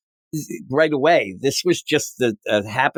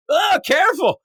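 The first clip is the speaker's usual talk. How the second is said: mock alarm. Lex dangled at death's door.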